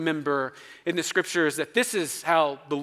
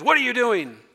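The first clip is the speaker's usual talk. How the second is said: put-on higher, louder mom catching her kid slacking; mom catching you; 8 semitones higher, 5 dB louder than usual. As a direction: snap it like mom in the doorway. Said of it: "and she goes".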